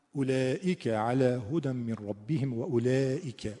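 slow recitation of اولئک علی هدی with Arabic vowels; recited Quranic demo. Shown as a demonstration. Teacher demonstrating recitation to students, slow and chanted. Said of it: "مثلاً".

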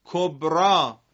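کبرا read ending in a long a sound, not kobri; model reading. Shown as a demonstration. Teacher shows the class how کبری is read. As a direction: stress the final a. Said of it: "کبرا خونده میشه".

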